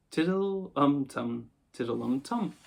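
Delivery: drawn-out, careless nonsense refrain; sing-song it without a care; utterly careless. A narrator's reading. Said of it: "more carelessly still".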